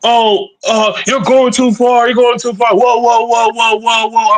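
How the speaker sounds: high-pitched mimicked objection; mock protest. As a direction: imitate someone frantically calling a halt. Mimicking someone objecting in retold argument.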